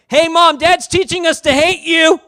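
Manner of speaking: imitating kids telling on dad, shouted high; tattling kids. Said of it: "They all turn like this to Michelle".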